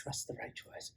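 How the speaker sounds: breathy whisper under the breath. Acted. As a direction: whisper it to yourself. Whisper before the big shout.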